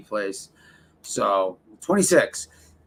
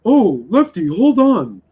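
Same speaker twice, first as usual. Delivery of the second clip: high, louder whiny plea; mock rival pleading; 6 semitones higher, 6 dB louder than usual. Streamer imitating rival stalling him, high whiny plea. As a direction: wheedle like a caught liar. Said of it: "he'd be like".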